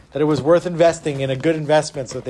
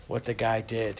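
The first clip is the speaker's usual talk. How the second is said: plain reading voice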